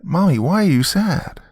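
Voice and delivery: put-on high, questioning child voice; little girl. Daughter asking her sad mother, little-girl voice. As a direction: ask as a concerned small girl.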